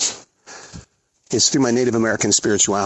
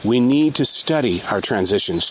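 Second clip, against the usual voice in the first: normal talking voice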